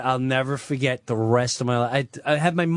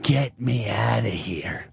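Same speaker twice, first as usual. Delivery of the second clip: father's plea; 4 semitones lower than usual. Retold or imitated.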